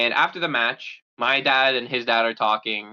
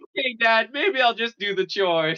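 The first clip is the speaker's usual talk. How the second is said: meek son; 11 semitones higher than usual. Retold or imitated. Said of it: "He's like".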